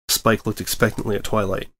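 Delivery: usual speaking voice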